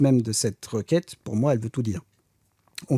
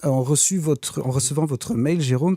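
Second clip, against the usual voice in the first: usual speaking voice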